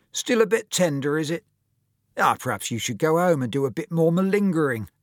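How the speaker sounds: high, loud mocking taunt; sneering. Bully eyeing Tom's protective hand, sneering. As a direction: needle him with false concern. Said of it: "Devlin sneered".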